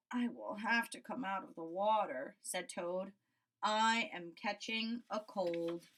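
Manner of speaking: low, glum toad voice; resigned and chilly; shivering Toad. Acted. Toad giving in to the cold, low and glum.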